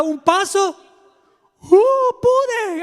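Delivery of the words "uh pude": high, loud squeal of delight; like a kid who just rode a bike; thrilled cry. Acted. Pedro, amazed after his first step, squeals with joy.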